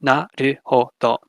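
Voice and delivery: "Naruhodo" said slowly, each syllable a clear consonant plus vowel, na-ru-ho-do; Japanese example word. Shown as a demonstration. Teacher to class, demonstrating open syllables: slow careful Japanese. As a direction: spell out "Naruhodo" syllable by syllable, no clusters. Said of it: "each syllable in this is just a consonant and a vowel".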